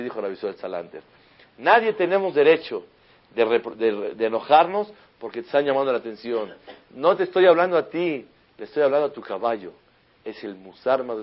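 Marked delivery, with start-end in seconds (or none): 1.6-9.7 loud, high, emphatic sermon delivery; fiery preaching. Acted. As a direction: drive the lesson home to the congregation. Rabbi preaching at full force.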